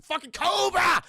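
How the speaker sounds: loud, high shout of the name; hammed-up outburst; mock villain yell. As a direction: bellow COBRA like a battle cry.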